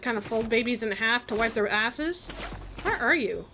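normal talking voice

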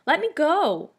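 high, loud squeal of protest; screeching. A narrator's reading. Boy held by the ear begs release, screeching. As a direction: squeal to be let loose. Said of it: "Ernie screeched".

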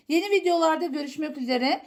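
ordinary talk

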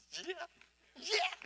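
ordinary delivery

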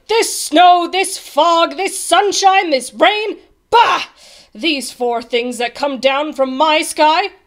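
loud, high royal tirade; shouting. A narrator's reading. King railing at what falls from his sky, shouting. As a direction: shout each thing out in disgust. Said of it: "he started shouting".

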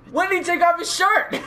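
high, squealing incredulous questions; baffled disbelief. Real, not acted. Player baffled by a character's changed shorts, high incredulous squeal. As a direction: squawk it in high-pitched bafflement.

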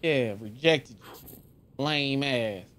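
ordinary talk, nothing marked

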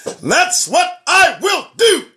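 loud vow. Real, not acted. Preacher vowing to remind listeners, loud and slow. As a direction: declare it like a preacher at full force. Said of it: "remind you loudly".

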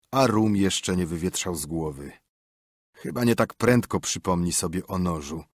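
ordinary delivery

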